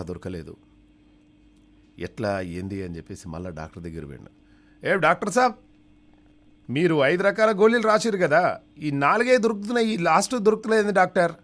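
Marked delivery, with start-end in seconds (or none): 4.9-11.3 very high, loud, strained complaining voice; whiny patient. Acted. Patient returning to the doctor about the missing pill, high and whiny.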